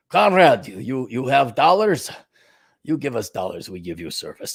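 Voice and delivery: low, softening mock Russian accent. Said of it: "what do Russians say?".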